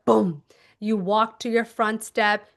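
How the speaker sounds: ordinary delivery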